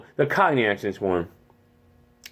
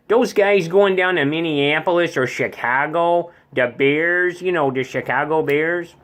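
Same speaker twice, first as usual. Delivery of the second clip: folksy upper Midwest accent; Minnesota accent; 3 semitones higher than usual. Shown as a demonstration. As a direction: chat like a Minnesotan local. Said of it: "Minnesota's sorta like this".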